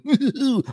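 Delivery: high creepy clown cackle; evil clown giggle. Pretend. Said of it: "the secular C D smashing clown".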